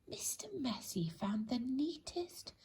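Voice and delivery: plain reading voice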